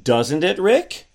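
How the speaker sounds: "Doesn't it, Rick?" climbing high on RICK; rising question tune. Shown as a demonstration. Asking co-host to confirm, pitch rising on RICK. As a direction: lift RICK sharply upward as a question. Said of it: "See how my pitch went up?".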